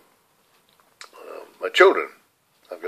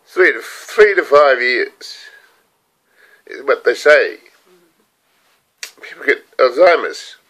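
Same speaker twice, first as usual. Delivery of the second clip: breaking into tears; 4 semitones higher than usual. Real, not acted. Old man telling viewers his diagnosis, crying. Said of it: "Bloody good cry".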